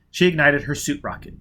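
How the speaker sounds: usual speaking voice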